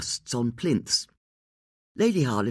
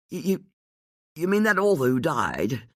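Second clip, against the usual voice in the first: stammered; 4 semitones higher than usual. A narrator's reading. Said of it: "he stammered".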